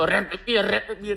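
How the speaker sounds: mock challenge. Mocking imitation.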